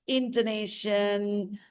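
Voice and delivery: usual speaking voice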